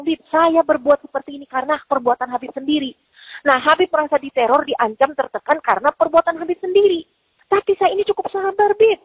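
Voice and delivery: rattling off her retold outburst at speed; retold raging.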